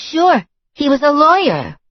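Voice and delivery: high, brassy and loud; tossing it off like it's obvious; brash girlfriend voice. A narrator's reading. Girlfriend answering the narrator, high and brassy.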